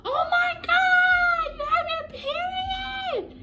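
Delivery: very high, loud, drawn-out shriek; imitated mom scream. Mom hears her daughter has her period, imitated screaming. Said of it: "My mom literally screamed".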